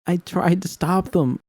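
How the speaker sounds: high, slow desperate plea; pleading. Acted. Narrator pleads with V over the fire, high and slow. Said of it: "I pleaded".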